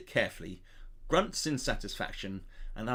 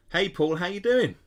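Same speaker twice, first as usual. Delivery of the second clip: cheery greeting; 7 semitones higher, 7 dB louder than usual. Pretend. Greets a book character cheerily.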